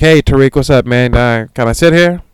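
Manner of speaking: a hint of an eager coworker; coworker Eric. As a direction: greet like an eager office buddy.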